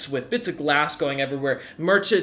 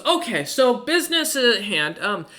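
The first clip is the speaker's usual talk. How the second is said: higher, businesslike clerk voice; dwarf banker voice; 5 semitones higher than usual. Acted. Banker leading James to the cubicle, higher and businesslike. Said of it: "he still kinda looks at you and goes".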